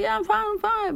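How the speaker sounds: high, airy insistence that all is fine; brushing it off. Retold or imitated. Tired listener reassures the friend, high and dismissive. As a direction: wave off the worry in a lifted voice. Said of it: "And you're like".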